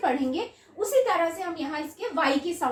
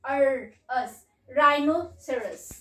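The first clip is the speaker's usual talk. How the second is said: slow sounding out; 3 semitones lower, much slower than usual. Shown as a demonstration. Teacher slowly blending rhinoceros for children. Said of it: "अब ये word कैसे पढ़ेंगे?".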